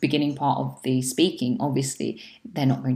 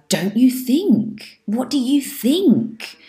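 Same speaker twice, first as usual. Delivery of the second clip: higher, slow rhetorical "Don't you think?"; rhetorical question tone; 9 semitones higher, 3 dB louder, slower than usual. Shown as a demonstration. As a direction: toss it out lightly, expecting no answer. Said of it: "if you say it in the right tone".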